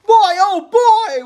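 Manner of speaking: put-on very high, slow and loud boyish joy; thrilled boy. A narrator's reading. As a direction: cheer like a kid at a touchdown. Boys celebrating touchdown.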